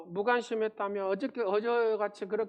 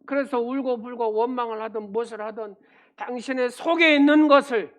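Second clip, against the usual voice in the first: loud, higher pulpit preaching at full force; fervent preaching; 5 semitones higher, 10 dB louder than usual. Acted. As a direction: press the message home to the congregation. Preaching climax to congregation.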